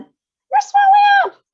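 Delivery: very high, very loud drawn-out scream, slow; bigger scream. A narrator's reading. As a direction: stretch the scream in full panic. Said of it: "screamed Miss Beauregard again".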